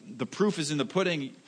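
ordinary delivery

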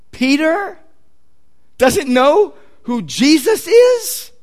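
drawn-out rhetorical disbelief; incredulous question. Acted. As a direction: ask it as if astonished, rising high. Preacher mock-incredulous at Peter's denial.

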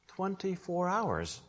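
soft, slow and measured; hushed. Real, not acted. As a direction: let the answer drop quietly, drawn out. Repeats answer for rhetorical effect.

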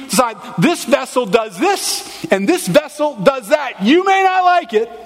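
full-force pulpit voice for the potter's rebuke; preaching God's voice. Acted. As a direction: thunder it out as God rebuking the clay.

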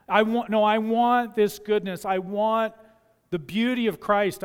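ordinary talk

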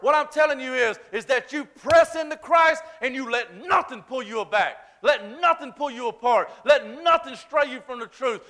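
rising, loud preacher's cry, pushing hard; preaching at full force. Acted. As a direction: preach it, pressing every phrase home.

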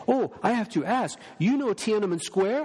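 put-on a touch of a Chinese accent, eagerly asking; mild Chinese accent, curious question. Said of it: "a very strong accent".

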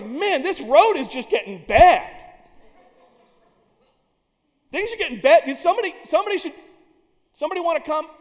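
hammed-up nervous temple helper grumbling; acolyte voice. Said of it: "and goes".

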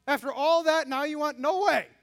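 an ungracious Jesus, hammed up as a mock angry protest; mock indignant shout. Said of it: "Is that what Jesus says?".

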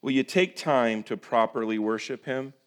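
ordinary talk

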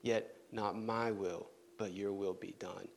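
soft, slow, sinking prayer; quiet surrender. Acted. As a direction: yield gently, almost to silence. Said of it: "he called out to God".

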